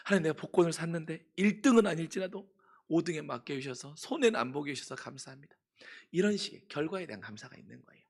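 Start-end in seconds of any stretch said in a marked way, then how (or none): 0.1-5.6 excited mock prayer.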